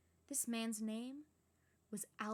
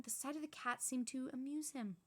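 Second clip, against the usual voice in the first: ordinary delivery, nothing marked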